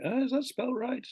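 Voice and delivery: high-pitched puzzled query; doubting thought. Acted. Teacher voicing a learner unsure of a spelling, doubtful. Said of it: "you'll think".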